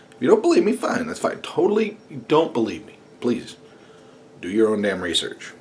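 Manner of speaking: usual speaking voice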